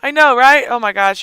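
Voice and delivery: ordinary talk, nothing marked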